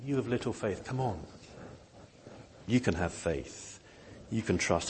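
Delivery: soft, gentle coaxing; reassure, never scold; tender, not harsh. Pretend. Jesus reassuring anxious followers, soft and gentle. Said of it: "not speaking in a harsh tone here".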